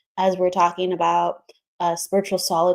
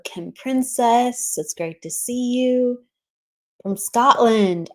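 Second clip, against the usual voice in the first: ordinary talk, nothing marked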